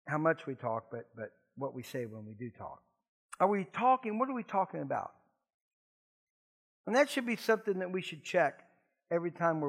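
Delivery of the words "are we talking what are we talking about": ordinary talk, nothing marked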